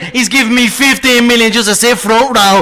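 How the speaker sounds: Irish brogue, laughing brag; cocky Irish accent, rolling with laughter; Conor McGregor impression. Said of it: "the Connor one".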